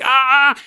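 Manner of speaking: short, high, loud yelps of pretend pain; mock pained yelps. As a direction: imitate someone being jabbed. Acting someone poked with spear.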